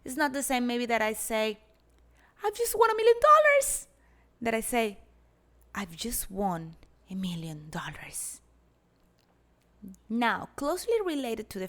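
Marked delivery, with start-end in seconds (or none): 2.4-3.9 much higher and louder, ecstatic cry; excited. Shown as a demonstration. Teacher demonstrates excitement, much higher and louder. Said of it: "A fast rhythm ... may indicate excitement". 5.8-8.4 whispered, slow and hushed; calm whisper. Shown as a demonstration. Teacher contrasts with calm, whispered and slow. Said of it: "slow rhythm may suggest calmness".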